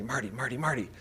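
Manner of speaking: hushed, nudging a snorer awake; murmured Marty, Marty, Marty; soft wake-up whisper. Retold or imitated. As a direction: quietly say his name to wake him. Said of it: "she'll shake me".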